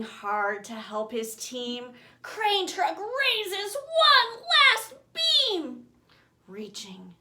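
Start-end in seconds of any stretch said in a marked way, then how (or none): 2.2-5.8 slow, loud, straining; strained effort. Acted. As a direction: heave it up with effort. Loud reading of lifting effort.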